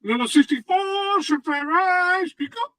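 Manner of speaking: high voice. Pretend. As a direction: pitch it way up. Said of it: "one of your high voices".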